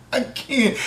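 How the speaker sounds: high, breathless laugh through "I can't"; giving up mid-laugh. Real, not acted. Reviewer cracking up at a dumb scene, laughing.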